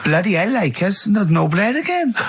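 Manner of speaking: high, indignant grumble about the bread; a hammed-up shocked neighbour; mock villager complaint. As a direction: play a put-out village local. Voicing village shopper complaining.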